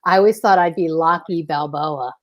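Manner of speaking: ordinary delivery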